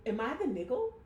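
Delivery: ordinary talk, nothing marked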